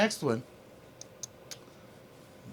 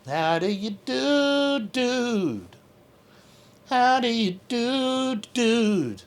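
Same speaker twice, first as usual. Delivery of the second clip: higher, slow greeting to the cat; pet talk; 6 semitones higher, slower than usual. Real, not acted. Greeting the kitten up close, higher slow pet talk. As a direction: draw each word out high for the cat.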